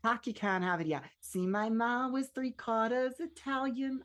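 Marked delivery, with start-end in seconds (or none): 1.2-4.0 slow, raised-pitch amateur Boston accent; effortful imitation of a Boston accent, drawn out; second-try Boston accent. Shown as a demonstration. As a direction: do your best Boston accent, stretching the line. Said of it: "I'm trying my best".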